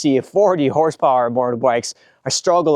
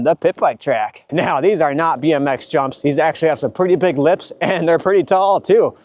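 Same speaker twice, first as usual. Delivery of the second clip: ordinary delivery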